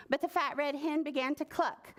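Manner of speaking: plain reading voice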